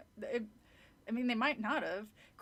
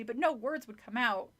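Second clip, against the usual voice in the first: plain reading voice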